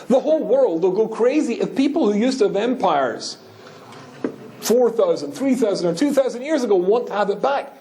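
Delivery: high-pitched mock outrage; outraged. Retelling Toynbee's argument against a state, high-pitched outrage. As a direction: build to a high, horrified protest. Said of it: "Toynbee was outraged and horrified".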